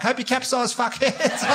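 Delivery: high, bright-waved insult shouted across the water; mock cheerful insult. Comic yelling an insult at passing boats. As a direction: wave sweetly while cursing them. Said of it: "Like that".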